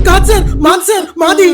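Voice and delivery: loud insistent shout, high; shouting claim. Acted. Thief shouts that the locker is theirs, loud insistent claim. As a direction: bark back the claim over him.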